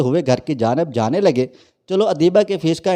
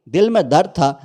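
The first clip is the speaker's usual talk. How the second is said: usual speaking voice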